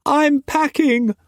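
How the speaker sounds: high, slow cheerful sing-song; bright, pleased announcement. A narrator's reading. Mei Mei telling Struan she is packing, cheerful and high. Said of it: "she said cheerfully".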